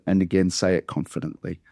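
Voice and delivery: ordinary talk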